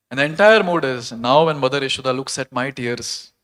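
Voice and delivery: ordinary delivery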